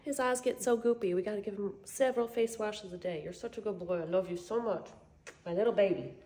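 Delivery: talking to a dog. Real, not acted. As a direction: coo gently to a puppy.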